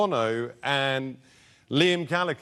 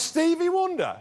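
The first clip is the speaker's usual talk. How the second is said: scoffing, much higher repeat of the name; incredulous; 12 semitones higher than usual. Retold or imitated. [name] scoffs at the guess, high incredulous echo. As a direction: throw the name back in disbelief.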